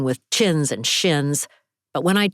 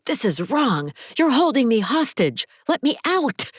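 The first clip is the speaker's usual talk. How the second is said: pounding and shouting; 9 semitones higher than usual. A narrator's reading. Hostage pounding the window, shouting at her captors.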